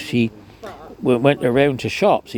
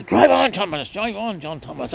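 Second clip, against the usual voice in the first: urgent call; 8 semitones higher than usual. Retold or imitated. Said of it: "I said".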